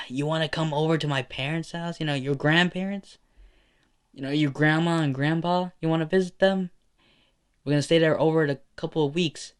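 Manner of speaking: a cheerful mother asking. Acted. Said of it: "she responds saying".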